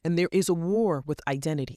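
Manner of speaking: ordinary talk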